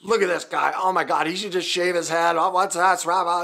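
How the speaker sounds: loud mock ridicule of a bald guy; mocking commenters. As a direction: ham up the online haters mocking him. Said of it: "people just started going off about my hair".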